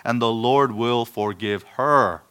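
"her" drawn out long at the end; stress on "her". Real, not acted. Preacher reading the verse to the congregation, stress on "her". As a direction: lean slowly on the final "her". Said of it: "Where's the emphasis? It's on the woman".

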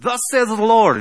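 loud, high imitation of a would-be prophet; high-pitched, booming mock pronouncement; mock prophetic proclamation. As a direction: proclaim it loudly, like a self-appointed prophet. Acting out self-styled prophet proclaiming.

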